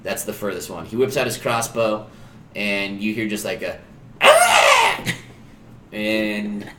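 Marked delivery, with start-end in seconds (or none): 4.2-5.0 very high, loud startled shriek; sudden high-pitched yelp of surprise; startled scream. Acted. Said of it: "That was just a startled scream".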